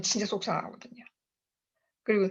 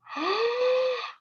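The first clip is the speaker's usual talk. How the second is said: amazed gasp; 13 semitones higher than usual. Retold or imitated. Amazed at the bonus plan.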